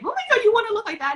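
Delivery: high-pitched rapid mock alarm; mum's shocked reaction. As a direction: squeal it like a horrified mother. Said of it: "And she was like".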